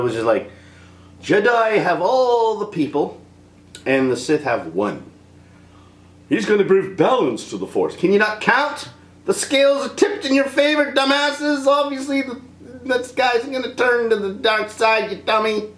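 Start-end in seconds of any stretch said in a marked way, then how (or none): 6.3-15.7 higher, scolding mock celebrity voice; Bill Cosby impression. Vlogger scolds the Jedi as Cosby, higher mock chiding. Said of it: "I'm doing Bill Cosby".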